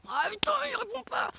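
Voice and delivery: fed-up postman yelling at the closed door; annoyed postman. Pretend. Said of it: "il va s'énerver à la porte".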